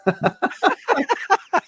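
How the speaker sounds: a squealing high laugh; high burst of laughter. Real, not acted. Host laughing as George threatens another joke, high laugh.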